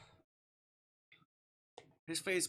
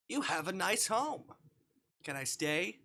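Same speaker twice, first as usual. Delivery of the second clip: voicing Verdi; 6 semitones higher, 6 dB louder than usual.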